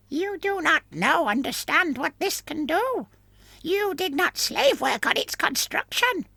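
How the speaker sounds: very high, whining voice through a mask; whining muffled voice. Acted. Companion's voice in the story.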